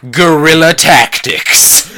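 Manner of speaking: loud, high and drawn out; hammed-up reveal, stretching each word; dramatic announcement. Host playing Ramba revealing his plan, hammed-up proclamation.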